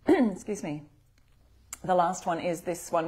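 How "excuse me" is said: ordinary talk, nothing marked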